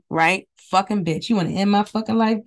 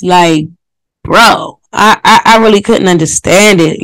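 usual speaking voice

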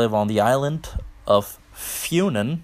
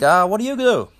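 mocking the guy's question; 9 semitones higher, 4 dB louder than usual. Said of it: "So he's like".

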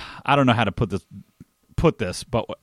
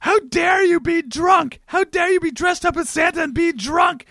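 high, slow, shrieking tirade; outraged yell. Retold or imitated. Carol berating a drunk Santa, high outraged shout. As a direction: screech it out like a scandalised lady with a broom.

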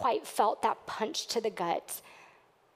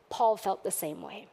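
normal talking voice

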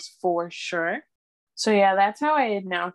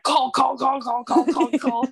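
loud, higher, repeated urging; raised-pitch frantic repetition; retold frantic urgency; 6 semitones higher, 4 dB louder, slower than usual. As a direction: chant it like a panicked command to yourself. Frantic urge to phone, retold.

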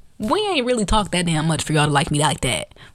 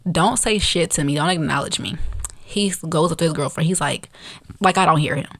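normal talking voice

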